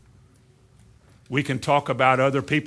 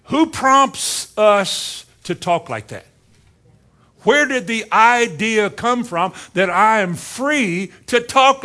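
slow, louder, rising preacher's challenge; preaching at full force; 4 semitones higher, 5 dB louder, slower than usual. Acted. Preacher challenging the congregation, slow and loud. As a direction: hammer each question home slowly.

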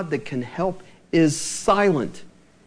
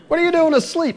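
much higher, louder mock exclaim; exasperated parent; 12 semitones higher, 4 dB louder, faster than usual. Parent exasperated at teenager asleep at noon, hammed-up loud exclaim. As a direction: throw up your hands at the sleeping teen. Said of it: "what we say to our teenagers".

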